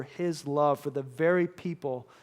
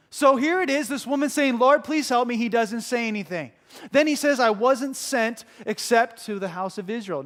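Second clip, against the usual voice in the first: higher, louder pulpit retelling; full-force preaching; 6 semitones higher, 6 dB louder than usual. Real, not acted. Preacher retelling the woman's plea to congregation, forceful. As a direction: retell it with raised, urgent force.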